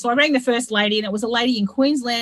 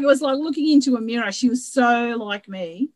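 ordinary talk

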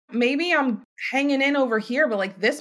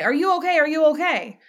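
urgent call; 7 semitones higher than usual. Retold or imitated. CPR rescuer tapping aggressively.

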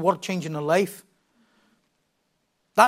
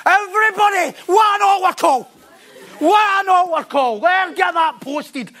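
higher, loud revival shout; mock altar call; 9 semitones higher, 3 dB louder, slower than usual. Preacher mocking a revival altar call, shouted and high. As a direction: ham up the big altar call. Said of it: "am I mocking it".

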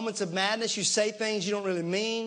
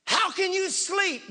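higher, slow indignant question; acted disbelief, pitched up; mock-incredulous challenge; 7 semitones higher than usual. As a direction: demand it as the alarmed doubter. Acts out the frantic challenge.

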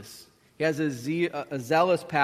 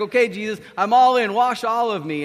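loud, high, eager outburst; so excited; 5 semitones higher, 7 dB louder than usual. Acted. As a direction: throw yourself in with excitement. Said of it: "He's so excited".

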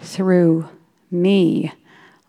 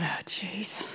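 whispered dismay; 16 dB quieter than usual. Retold or imitated. Dismayed inner thought.

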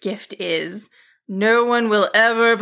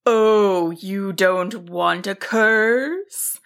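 slow, drawn-out mock concern in a wizard voice; sly; 2 semitones lower, much slower than usual. A narrator's reading. Wizard teasing the frightened sheep about the curse, slyly. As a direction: toy with your victim slyly. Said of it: "the wizard asked slyly".

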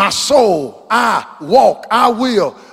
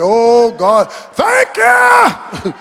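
hammed-up relieved thanks; 3 semitones higher, 4 dB louder, much slower than usual. Relieved exclamation acted.

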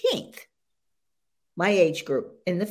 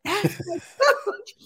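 laughing repeat; 9 semitones higher, slower than usual. Retold or imitated. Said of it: "you know".

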